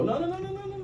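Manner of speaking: very high, soft run of no-no-no; poking fun. Pretend. Performer joking with the crowd about space, mock high voice. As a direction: tease in a tiny high-pitched voice. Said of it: "I'm poking, I'm poking fun".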